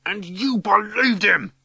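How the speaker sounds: high, loud, drawn-out roar of outrage; angry roar. A narrator's reading. Ryan rounding on someone who believed him, roaring. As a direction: roar it like a bull. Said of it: "Ryan's roaring like a bull".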